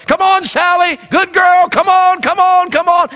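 high, loud coaxing call; calling the dog. Retold or imitated. Grandpa calling his dog out of the dark, loud and high. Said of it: "He's yelling for his dog".

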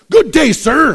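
high, loud indignant good day sir; huffy exit. Retold or imitated. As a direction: storm off offended. Young man storms out angrily.

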